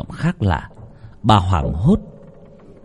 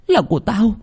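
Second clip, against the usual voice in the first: much higher, rising demonic claim on là của tao; possessed cry; 12 semitones higher than usual. A narrator's reading. Possessed girl claims the heart at her reflection, much higher. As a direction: fling it out high and possessive. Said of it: "nhìn mình trong tấm gương".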